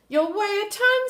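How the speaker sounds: high, loud scolding warning; scolding Martha. Acted. Martha scolds George's sweets.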